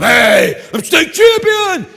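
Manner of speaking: high, loud, slow bragging yell; triumphant shout. Retold or imitated. Boy boasting to everyone with a high, loud, slow yell. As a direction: crow it like a kid who just won. Boys bragging about winning.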